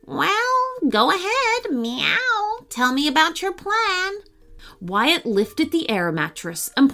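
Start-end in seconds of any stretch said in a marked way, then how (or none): 0.1-4.1 put-on high, light cat girl voice; cat answering the boy, in a high voice. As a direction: talk like a perky cat. Cat Lola's voice.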